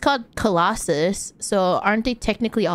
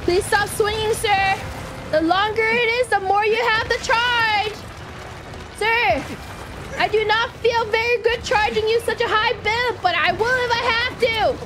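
high-pitched doctor voice; 10 semitones higher than usual. Acted. Plays a doctor charging client.

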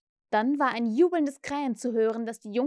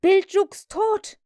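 loud, high, drawn-out exclamation; startled cry; 9 semitones higher, 8 dB louder, much slower than usual. A narrator's reading. Frightened pirates hear their mate is dead, startled cry. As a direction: cry out slowly in shock. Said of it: "riefen die aufgeschreckten Piraten".

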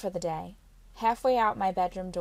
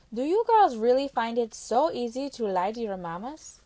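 louder reproving Carla; reproachful Carla; 4 semitones higher, 5 dB louder than usual. Acted. Carla scolding the girl for lying, louder. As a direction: shame her for lying.

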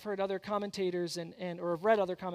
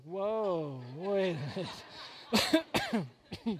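drawn-out whoa, wait a minute; mock surprise; much slower than usual. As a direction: fake shock at her choice. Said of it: "I was like".

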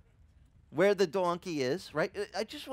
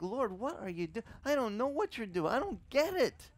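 exasperated plea to God, rising higher as it goes; confused complaint; 6 semitones higher than usual. Retold or imitated. Pastor voicing a lost believer, exasperated and rising. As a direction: whine it up to the Lord, climbing in pitch. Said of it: "we're just like".

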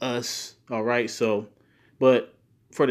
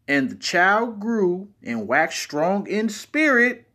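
slow, higher, louder; emphatic reading; 8 semitones higher, 6 dB louder, slower than usual. Shown as a demonstration. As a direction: swell on "waxed strong". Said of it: "if you put emphasis on certain words".

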